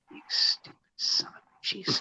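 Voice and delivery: hissed whisper; retold whispered insult. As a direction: whisper it in disgust. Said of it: "His girlfriend would look over and go".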